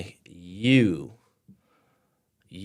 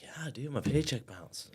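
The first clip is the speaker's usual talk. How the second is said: hushed admission; 6 semitones higher, 5 dB quieter than usual. Retold or imitated. Telling friend he can't pay.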